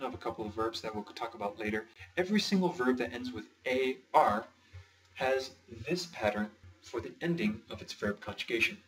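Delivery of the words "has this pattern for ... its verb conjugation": ordinary delivery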